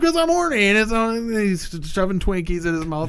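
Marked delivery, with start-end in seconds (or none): 0.0-0.7 squeaky hammed-up answer, much higher; mock high reply. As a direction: toss it off in a silly high voice. Man's reply voiced in retelling.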